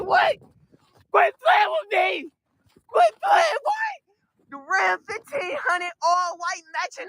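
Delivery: high, excited shouting, slow on the hype lines; overjoyed yelling. Real, not acted. Buyer hyping his new truck to viewers, high excited shouting. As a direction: scream your happiness at the truck. Screaming joy over dream truck.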